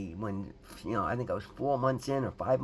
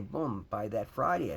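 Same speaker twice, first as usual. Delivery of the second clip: ordinary delivery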